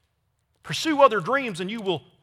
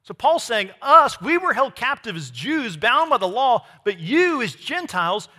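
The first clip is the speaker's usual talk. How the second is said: raised, emphatic preacher's voice; impassioned preaching; 3 semitones higher, 5 dB louder than usual. Real, not acted. Preacher assuring believers they are free, impassioned preaching. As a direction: drive each point home to the congregation.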